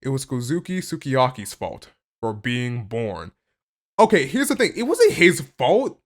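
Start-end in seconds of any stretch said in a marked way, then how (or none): 4.0-6.0 higher, louder, insisting; emphatic. Real, not acted. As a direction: set the record straight firmly. Insists character is blameless.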